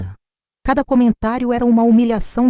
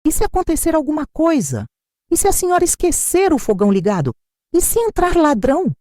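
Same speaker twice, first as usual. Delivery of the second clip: bem mais agudo, perguntas insistentes; ladainha de medo; 9 semitones higher than usual. A narrator's reading. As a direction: repita os perigos com falsa aflição. (nora repetindo perguntas alarmantes)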